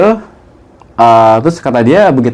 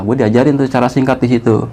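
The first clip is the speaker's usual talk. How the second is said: ordinary talk, nothing marked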